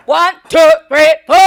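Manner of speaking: barked marching cadence, very loud; drill sergeant cadence. Acted. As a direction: shout the count like a drill instructor. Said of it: "do a little cadence".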